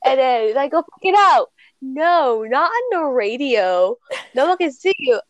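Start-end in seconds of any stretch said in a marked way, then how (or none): 0.7-1.5 mock reply. Mimicking a reply.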